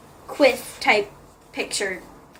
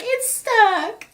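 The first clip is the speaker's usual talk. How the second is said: much higher squeaky put-on voice; Hazel stuck, squeaky; 10 semitones higher than usual. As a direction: whine it like she's stuck in the shirt. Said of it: "she's just like".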